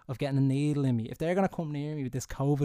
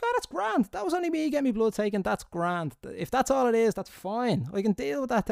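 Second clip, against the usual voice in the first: relieved; 8 semitones higher than usual. Retold or imitated. Relief after the needle.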